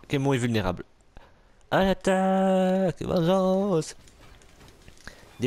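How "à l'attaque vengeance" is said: high, slow heroic war cry; rally like a vengeful hero, drawn out; hammed-up battle cry. Gamer shouts a battle cry as Nightwing attacks, hammed-up.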